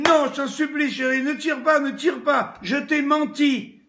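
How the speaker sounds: high, loud desperate begging; wife begging husband with gun not to shoot; pleading wife. Acted. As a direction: beg him not to pull the trigger. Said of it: "elle dit".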